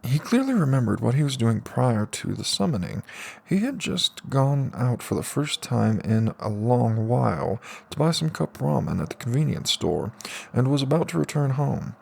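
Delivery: ordinary delivery, nothing marked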